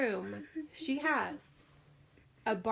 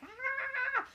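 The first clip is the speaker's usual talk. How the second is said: short high mock scream of a little girl; imitated scream. Mom imitating her daughter's high-pitched scream. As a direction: squeal it like a scared child. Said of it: "I hear this blood-curdling scream".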